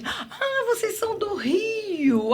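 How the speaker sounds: high, slow, gushing delight; gushing host. Retold or imitated. Party promoter greeting Rio visitors, high gushing voice. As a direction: imitate the overexcited promoter greeting you. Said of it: "falou assim".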